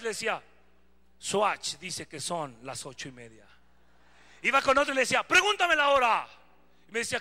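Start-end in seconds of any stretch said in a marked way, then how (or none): 1.2-3.5 low, slow, self-important announcement; presumiendo el reloj. Retold or imitated. Imita su respuesta presumida con el reloj. 5.2-6.3 loud, high, eager demand; eager show-off. Retold or imitated. Vuelve a pedir la hora, presumiendo.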